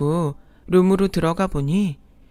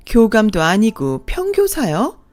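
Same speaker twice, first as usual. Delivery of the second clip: higher, louder snide stepmother voice; sneering second mother; 5 semitones higher, 4 dB louder than usual. Acted. Second mother mocking the bride's parents, sneering and snide. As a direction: look down on the bride's family with contempt.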